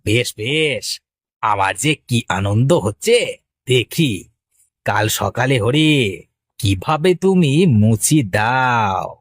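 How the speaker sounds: low, drawn-out gloating; sly villain's monologue. Acted. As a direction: savour the sabotage in a low voice. Rival gloats over sabotage.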